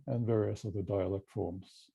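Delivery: ordinary talk, nothing marked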